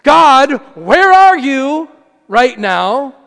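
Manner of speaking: loud, drawn-out cry to God; pleading at full volume; desperate prayer. Acted. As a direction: call out slowly and loudly for an absent God. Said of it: "the desert prayer is saying".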